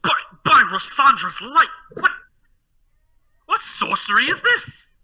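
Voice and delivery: high-pitched flustered astonishment; aghast, voice leaping upward; stunned noble. Acted. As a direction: splutter in theatrical disbelief. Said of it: "he just kinda seems stunned".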